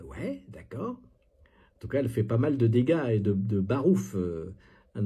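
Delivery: normal talking voice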